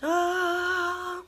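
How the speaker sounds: long high soft ah sound; meditative ah. Shown as a demonstration. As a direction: sing out a calm ah. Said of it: "You have to go".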